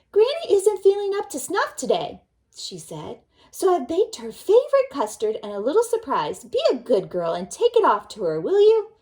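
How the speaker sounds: bright motherly call to her daughter; mother's voice. A narrator's reading. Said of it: "mother called her".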